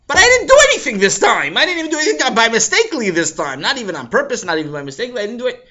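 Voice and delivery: loud high denial; loud protest. Acted. Avi to the boys, loud protest. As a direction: insist loudly.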